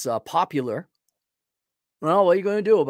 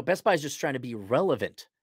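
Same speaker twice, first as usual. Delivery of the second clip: ordinary talk, nothing marked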